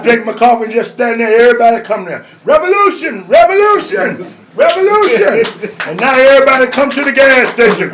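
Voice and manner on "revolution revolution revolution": called out high, drawn to a falling end; mock crowd call. Gas station regulars greet the activist, high mock call. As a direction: imitate people hailing you by a slogan.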